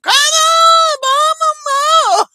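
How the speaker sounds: high, loud, cartoonish shout; mock Trump egging on. Voicing Trump urging Netanyahu on, a hammed-up high shout.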